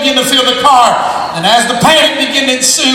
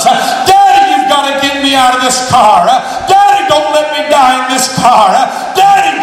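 screaming at the top of her lungs; 3 semitones higher than usual. Acted. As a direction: shriek it like a trapped girl begging. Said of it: "she screamed at the top of her lungs".

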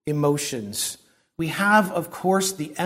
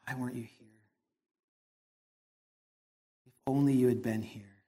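much softer, grief-stricken plea, barely voiced; weeping Mary; 5 semitones lower, 11 dB quieter, faster than usual. Mary to Jesus after her brother died, very soft weeping. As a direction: breathe it out as a broken, weeping question. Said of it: "Just that weeping".

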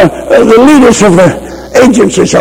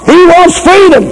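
first, higher call; high ringing preacher cry; 9 semitones higher than usual. Real, not acted. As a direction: fling it out high over the crowd. Repeated cry to the congregation.

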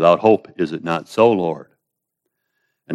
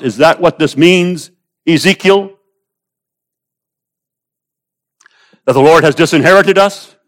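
higher and louder, at full force; fiery preaching; 7 semitones higher, 6 dB louder than usual. Real, not acted. Preacher to his congregation about the Lord's anger, full force. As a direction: preach it hard, pressing the point.

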